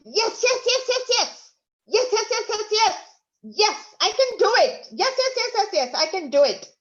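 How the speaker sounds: high, loud, triumphant yes-chant; strong, powerful tone. Shown as a demonstration. Teacher leads the class in a powerful, joyful yes chant. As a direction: shout your yeses like you just won. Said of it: "in a strong, powerful tone".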